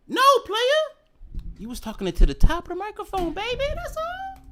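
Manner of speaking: high sing-song scolding; mocking falsetto. Streamer mocks himself for using the wrong side, high. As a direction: squeak it out like a sassy scold.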